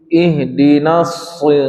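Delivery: Ihdinas shi- slowly, the shad thick; heavy shad. Shown as a demonstration. Teacher shows the thick shad to the class, slowly. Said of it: "yang tebal shad bukan na-nya".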